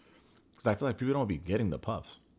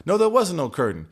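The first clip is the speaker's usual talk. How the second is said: higher, louder retold protest; mock heated denial; 8 semitones higher, 7 dB louder than usual. He insists on no curtain, raised mock protest. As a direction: protest loudly, acting out his side.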